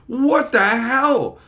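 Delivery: high, loud, drawn-out astonishment; acted-out incredulous outburst; shocked disbelief. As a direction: stretch it out in stunned disbelief. Retold shocked reaction to ruined pipe.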